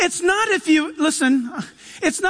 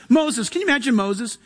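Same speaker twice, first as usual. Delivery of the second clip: normal talking voice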